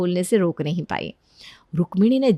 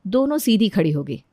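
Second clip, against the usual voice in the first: plain reading voice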